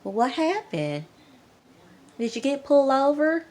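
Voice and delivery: mock Southern mom firing questions; country mom. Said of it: "Mom was like".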